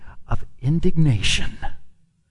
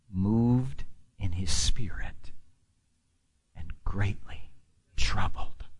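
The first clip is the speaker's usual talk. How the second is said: whisper; 9 semitones lower, 9 dB quieter than usual. Real, not acted. Preacher reading scripture softly.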